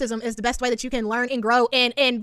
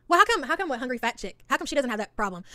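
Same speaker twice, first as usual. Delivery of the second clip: ordinary delivery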